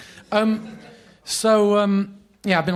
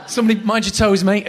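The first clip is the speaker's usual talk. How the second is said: loud, higher jokey warning; mock alarm; 6 semitones higher, 10 dB louder than usual. Host joking about dropping a name, loud mock warning. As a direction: cry out as if something fell.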